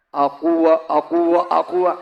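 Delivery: low repeated "aqua"; imitated gushing sound. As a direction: chug the word like pouring water. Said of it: "Kalau ada yang kencing lagi". (tiruan bunyi air bernada rendah)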